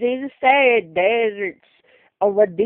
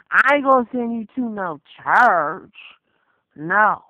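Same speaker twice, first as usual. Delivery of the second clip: usual speaking voice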